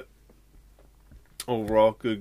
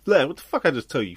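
mock yell; 5 semitones higher, 9 dB louder, faster than usual. Mock scolding outburst.

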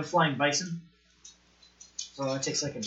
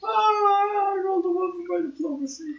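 laughing. Real, not acted. Player groans about failed roll.

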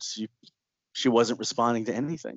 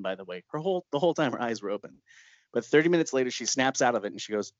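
ordinary delivery

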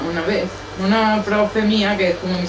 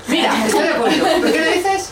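much higher and louder, through laughter; laughing; 11 semitones higher, 4 dB louder than usual. Real, not acted. Guest answering the man's remark, laughing. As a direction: laugh back at the challenge.

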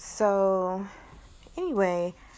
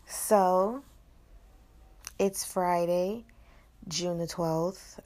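slowed down; slower than usual. Shown as a demonstration. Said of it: "practice today is kinda slowing down".

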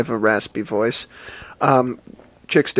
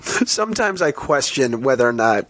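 ordinary talk

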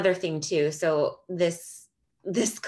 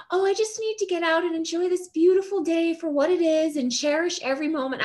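put-on sweet old man; 10 semitones higher than usual. Imitating the old neighbour's high sweet thanks as she holds the door.

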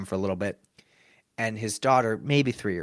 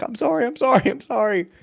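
high-pitched mock panicked apologizing; frantic apology; 12 semitones higher, 3 dB louder than usual. Mascot to a child he made cry, frantic high apology. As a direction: repeat it in a high, flustered voice.

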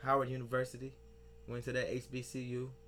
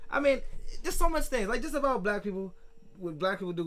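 exasperated; 7 semitones higher, 5 dB louder than usual. Real, not acted. Exasperated rant.

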